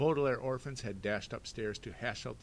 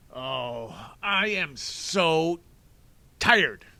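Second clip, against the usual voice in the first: very loud, slow, higher snarl; 6 semitones higher, 14 dB louder, much slower than usual. Acted. Stefano snarling at Klaus blocking his way. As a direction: spit it out in fury. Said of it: "Stefano snarled".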